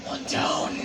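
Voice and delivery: unvoiced, menacing rasp of a killer; depraved killer. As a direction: whisper it like a depraved animal. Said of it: "looking like a depraved animal".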